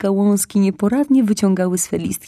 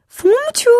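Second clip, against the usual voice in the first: second call much higher and louder, rising; mother calling; 6 dB louder than usual. Acted. Mother fox calling her son again, loud call. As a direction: call once more, sing it out. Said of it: "zawołała mama".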